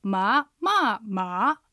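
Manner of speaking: three drawn tones, rising, falling, rising; tone demonstration. Shown as a demonstration. Teacher checking the tone flow on マ, tone demonstration. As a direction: sing out マ three times as second, fourth, second tone. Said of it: "マの発音を使ってアクセントの流れを確認すると".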